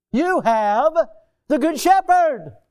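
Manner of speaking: high, loud "Good Shepherd" ringing out; rising shout of assurance; building to a cry. Real, not acted.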